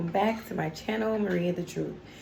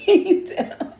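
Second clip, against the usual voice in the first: giggly; 12 semitones higher, 8 dB louder, slower than usual. Real, not acted. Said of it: "see me crying like this".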